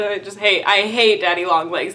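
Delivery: ordinary talk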